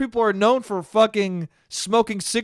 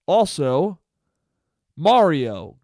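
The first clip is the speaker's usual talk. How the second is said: ordinary delivery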